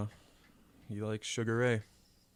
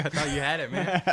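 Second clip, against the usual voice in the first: said through laughter, pitched up and loud; laughing; 7 semitones higher, 8 dB louder than usual. Real, not acted. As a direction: laugh out a teasing apology. Laughing apology after failed impression.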